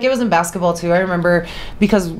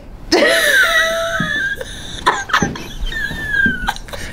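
high, breathy strained laugh; whispered pained laugh. Real, not acted. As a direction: laugh through the pain, whispering. Laughing at tattooing pain.

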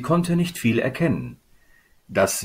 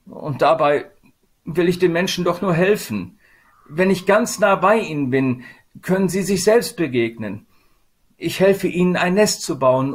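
higher, earnest voice of Sadness; pleading Sadness; 7 semitones higher, 4 dB louder than usual. Acted. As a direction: plead to be understood as a helper.

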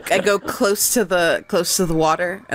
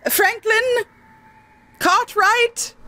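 high unsure naming; much slower than usual. Real, not acted. Hesitantly inventing names.

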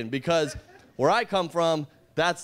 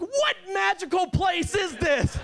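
high, slow, wide-eyed awe; amazed wonder; 10 semitones higher, slower than usual. Retold or imitated. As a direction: marvel at it slowly. Amazed reaction.

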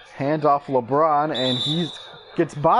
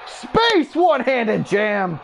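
excited dunk call; 5 semitones higher, slower than usual. Real, not acted. Commentator calls LeBron's one-handed jam, thrilled. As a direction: voice jumps very high, then comes down.

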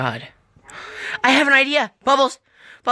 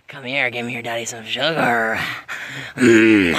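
put-on creepy boyfriend; 8 semitones lower than usual. Buck luring Blossom closer, low sleazy croon. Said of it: "Kiss me".

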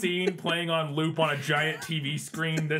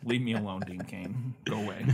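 grumpy low voice; 8 semitones lower, 6 dB quieter than usual. Pretend. Mocking reply to Dean Cain.

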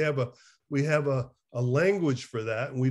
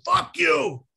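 a louder mock "Fuck you" thrown out in a workshop; retold angry yell; 3 semitones higher, 6 dB louder than usual. Recalling a session of venting, mock angry yell. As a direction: spit it like pent-up anger let loose. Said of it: "goddamn angry and screaming".

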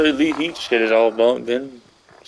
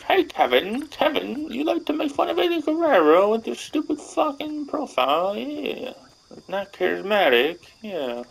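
high falsetto mocking whisper-taunt; high teasing voice mocking a YouTuber named Kevin; 12 semitones higher, slower than usual. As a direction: taunt him in a silly high sing-song.

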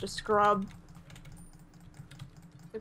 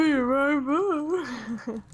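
very slow game voice; announcer voice; 4 semitones higher, 4 dB louder, much slower than usual. Pretend. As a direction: stretch it like an announcer. Echoing game voice.

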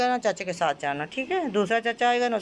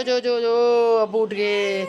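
louder again, insisting he goes; louder urging; 5 semitones higher, 6 dB louder, slower than usual. Real, not acted. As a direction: go go go, called out louder. Urging child to go to dad.

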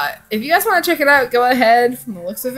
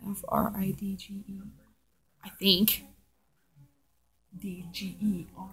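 whispered spelling, much softer; 2 semitones lower, 15 dB quieter than usual. Real, not acted. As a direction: whisper the letters under your breath. Spelling a word while off-camera.

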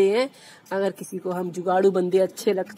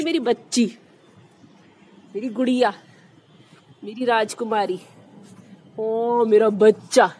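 tender. Real, not acted. Fond pet names for her pet.

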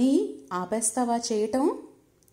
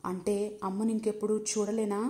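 ordinary delivery

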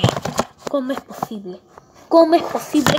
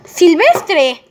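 calling out; 7 semitones higher, 9 dB louder than usual. Acted. Calling Silvestre before leaving.

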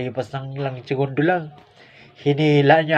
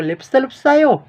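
much higher, slow and drawn-out teasing dare; cheeky dare; 8 semitones higher, 4 dB louder, slower than usual. Retold or imitated. Daring the commander to check for alcohol, high slow tease. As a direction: stretch it out high to provoke.

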